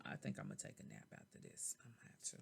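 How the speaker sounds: drowsy and very soft; sleepy mumble. Real, not acted. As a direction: fade into a sleepy murmur. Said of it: "'cause I'm a little tired".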